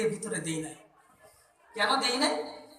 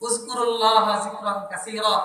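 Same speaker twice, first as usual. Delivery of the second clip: Quranic recitation; 4 semitones lower than usual. Real, not acted. Quotes Quranic verse.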